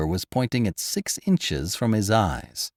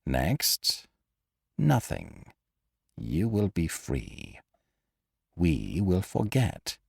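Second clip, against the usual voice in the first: softer, slow, hollow menace; threatening gunman, hollow and deliberate; 6 dB quieter, much slower than usual. A narrator's reading. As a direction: let each syllable fall slowly and coldly. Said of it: "spoke in a hollow voice".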